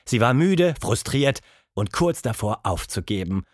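ordinary delivery

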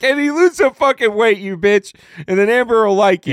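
loud, high-pitched mock scolding; mock berating. Host teasing a friend on air, loud mock berating. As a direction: raise pitch and volume, jokingly lay into him. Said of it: "Kenny's gonna be like".

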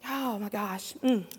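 ordinary talk

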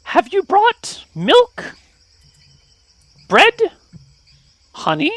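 high little fey begging for treats; tiny brownie voice. Acted. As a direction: ask sweetly in a tiny creature squeak.

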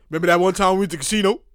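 low, laughing mock brag; teasing brother. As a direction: gloat like the lucky brother rubbing it in. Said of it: "I'm never gonna hear the end".

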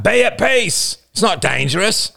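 high-pitched urgent call; crying out. A narrator's reading. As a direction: call out to calm the tribe. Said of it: "Roman cried out".

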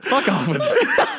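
put-on laugh-whine the brush-off in the kid voice; whiny kid. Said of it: "sixth grade".

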